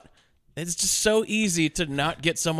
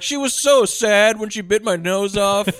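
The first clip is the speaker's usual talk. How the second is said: mock sad dog owner; 5 semitones higher, 5 dB louder than usual. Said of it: "And they'll be like".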